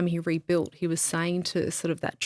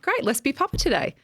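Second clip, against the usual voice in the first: cheery mock enthusiasm; 9 semitones higher, 5 dB louder than usual. Mum cheering on her son.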